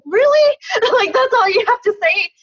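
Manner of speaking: high, loud disbelieving really; retold shock at the teacher; incredulous really. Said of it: "And I thought".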